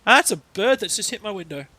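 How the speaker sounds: sudden loud high outburst; jolted by a thud at the window; startled cry at a bird. Real, not acted.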